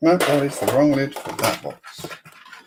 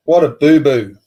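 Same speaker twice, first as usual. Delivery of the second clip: What a boo-boo called out louder; louder exclaim; 8 dB louder than usual. Real, not acted. Scolding Lego's sellout blunder to chat, called out louder. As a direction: call it out loud.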